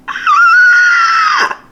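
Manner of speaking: sudden high yelp; startled shout. Real, not acted. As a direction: yelp in surprise.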